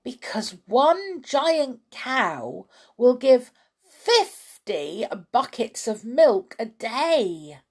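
loud grand announcement; excited cry. A narrator's reading. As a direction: proclaim it, drawing out the big number. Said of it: "cried Mister Cranky".

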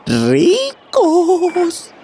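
high gleeful shout of riches; gleeful cry. Acted. Pirates imagining their riches, gleeful cry. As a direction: burst out with greedy glee. Crew exclaims dream of riches.

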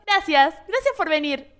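quick, dismissive thank-you; mock curt thanks. Singers dismissing the fan after the photo, coldly.

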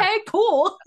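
high, loud, thrilled outburst; retold excitement. Recalling a glimpse of AOC's car, high excited outburst.

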